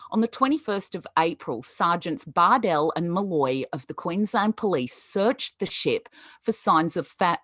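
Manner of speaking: ordinary talk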